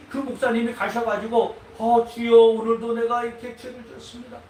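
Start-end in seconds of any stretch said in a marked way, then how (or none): 1.8-4.4 louder pleading prayer; mock repentance prayer. Pastor confesses sin to God, a louder pleading prayer. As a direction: cry out to the Lord in confession.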